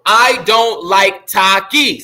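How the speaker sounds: slow, evenly spaced syllables; poem recitation. Shown as a demonstration. Teacher going over his haiku again, slow syllable-by-syllable recitation. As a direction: count out the syllables as you recite.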